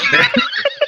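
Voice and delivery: big high cackle; loud laughing. Real, not acted. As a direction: burst out laughing.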